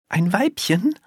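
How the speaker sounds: put-on high, baffled little girl; high-pitched astonished question. A narrator's reading. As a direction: echo it back in puzzled surprise. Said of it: "sagt Liane verblüfft".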